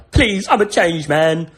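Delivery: acted tearful plea; pleading cry. Scrooge begs the spirit for mercy, acted pleading cry. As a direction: plead like a desperate Scrooge, lightly voiced. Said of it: "he cried".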